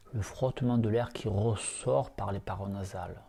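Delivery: soft, hushed meditation voice, unhurried; guided meditation. Acted. Teacher guiding lying students through yoga nidra, soft meditation voice. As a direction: ease listeners into calm breathing awareness.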